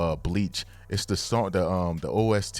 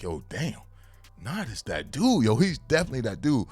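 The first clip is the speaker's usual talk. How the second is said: higher and louder, hyping up Knot; hyped reaction; 6 semitones higher, 3 dB louder than usual. Retold or imitated. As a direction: gush like a fan who just saw his hero. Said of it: "I was kinda like".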